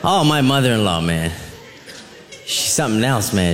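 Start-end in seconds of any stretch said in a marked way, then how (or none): none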